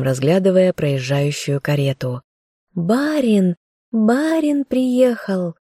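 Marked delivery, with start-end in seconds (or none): 2.7-5.5 high-pitched, drawn-out excited calls; children calling out. A narrator's reading. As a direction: call out like village kids spreading news. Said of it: "кричали они невпопад". (звонкие детские выкрики)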